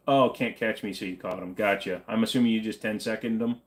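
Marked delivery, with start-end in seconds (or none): none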